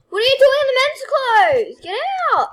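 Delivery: high, loud laughing yell; laughing shout. Real, not acted. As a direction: shoo her out with a laughing shout. Chasing sister from men's clothes.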